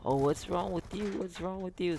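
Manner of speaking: put-on high, concerned voice; concerned auntie. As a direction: mimic an auntie asking what's wrong. Auntie voiced asking crying child.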